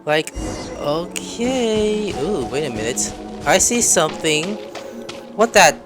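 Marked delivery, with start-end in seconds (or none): none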